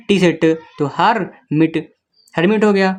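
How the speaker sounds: ordinary talk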